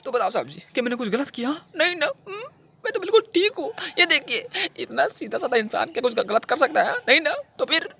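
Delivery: high, unhinged whine; desperate pleading. Acted. Killer asks officer to agree he is innocent, high whining plea. Insists he did nothing wrong.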